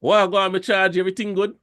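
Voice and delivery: Jamaican patois greeting. Retold or imitated. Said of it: "'Cause if you say".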